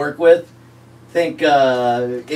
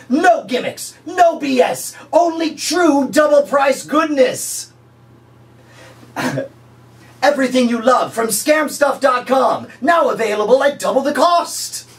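hard sell pitch; 10 semitones higher than usual. Pretend. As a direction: push the fake deal with cheesy gusto. Infomercial sales pitch.

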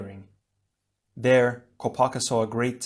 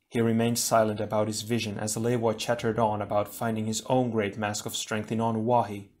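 ordinary delivery

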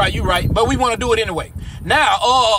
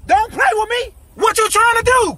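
higher, shouted threat; angry shout; 10 semitones higher than usual. Acted. Robber threatens victim reaching down, high angry shout. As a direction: bark it at him like you'll shoot.

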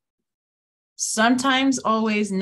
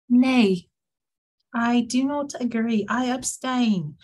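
put-on British accent; 3 semitones higher than usual. Shown as a demonstration. Teacher demonstrates voting nay for her students, put-on British accent. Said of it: "like, an accent".

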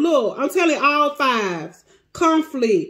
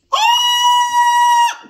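very high, loud sustained "oh"; overwhelmed wail; 6 dB louder than usual. Real, not acted. Reader overcome by Tori's energy, high wail. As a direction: let out a long high cry. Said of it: "Calm down".

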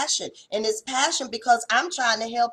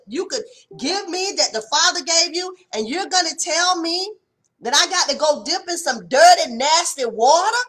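offended complaint; 6 semitones higher, 4 dB louder than usual. Retold or imitated. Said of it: "he was in his feelings".

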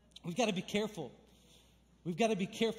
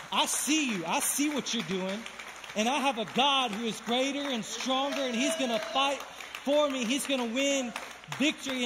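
building from firm to a loud, higher declaration; calling out the enemy; 4 semitones higher, 6 dB louder than usual. Shown as a demonstration. Pastor shows the church how to call out the enemy, building to a loud declaration. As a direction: rebuke the enemy, louder and higher with every line. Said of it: "You just need to call it out".

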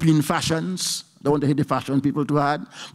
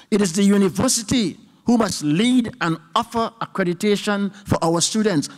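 ordinary talk

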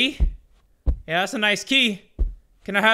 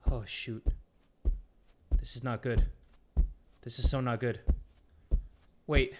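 very soft, low dread; hushed, worried self-talk; nervous muttering; 9 semitones lower, 11 dB quieter than usual. Real, not acted. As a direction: mutter it under your breath. Creeping scared through horror game.

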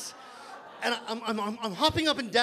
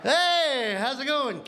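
pushy pickup guy; 3 semitones higher than usual. Retold or imitated. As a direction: play a sleazy guy at a club who snaps into a yell. Guy hitting on women.

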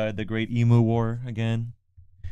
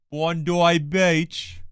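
put-on failed Australian accent; 6 semitones higher, much slower than usual. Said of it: "Did I attempt an Australian accent?".

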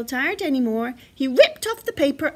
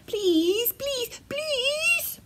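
high pleading, climbing to a squeak; begging; 12 semitones higher, much slower than usual. A narrator's reading. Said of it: "begged Baby Bear".

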